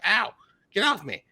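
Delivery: high, loud mock protest; exaggerated shriek of annoyance; imitating pet owner's yelp. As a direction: yelp it as if a bird landed on you. Mimics her yelling at bird.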